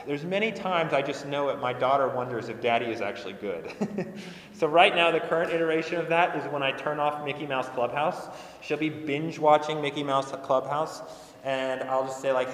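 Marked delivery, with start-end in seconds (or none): none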